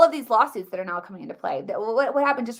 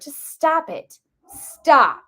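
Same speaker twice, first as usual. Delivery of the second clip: exasperated stop; 4 semitones higher, 7 dB louder, much slower than usual. Real, not acted. Fed-up rant at critics.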